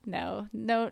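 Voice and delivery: ordinary talk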